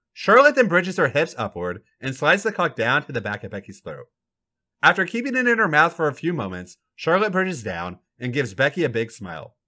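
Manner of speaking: plain reading voice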